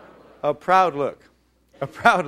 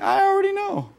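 "I already know" jumped far higher in a mock squeal; mocking know-it-all; 3 dB louder than usual. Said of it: "we're communicating to them".

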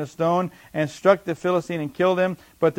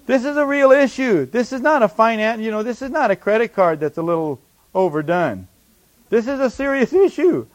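high, louder, overdone protest; mock worried protest; 4 semitones higher, 4 dB louder than usual. Preacher imitating a believer insisting on real issues, worried protest. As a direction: plead that your trouble is truly serious.

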